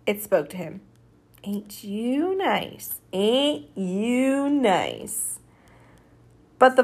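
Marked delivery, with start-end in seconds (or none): 1.4-5.4 very slow, stretched-out words; slow drawn-out taunt. Acted. Dream buffalo speaks to the sleeper, slow drawn-out taunt. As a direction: draw each word out long, dreamlike.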